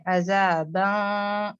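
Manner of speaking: عذاباً recited very slowly with a thick nasal ending; heavy ikhfa recitation. Shown as a demonstration. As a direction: draw out عذاباً with a full, heavy nasal close. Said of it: "یہ موٹا فیل بھی ہوتا ہے". (عذاباً کو آہستہ اور موٹی ناک کی آواز سے پڑھیں)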